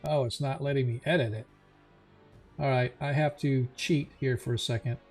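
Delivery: ordinary delivery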